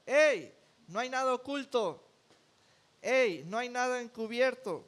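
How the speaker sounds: high, loud call of warning; a preacher voicing Jesus calling out loud and high. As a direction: cry out to the crowd, urgent and commanding.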